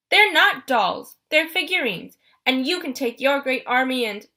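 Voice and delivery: put-on hurt kid snapping back; boy protests to the monster, loud and offended; indignant outburst.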